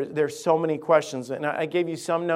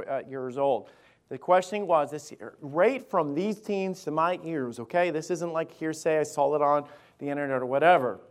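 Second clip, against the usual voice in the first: normal talking voice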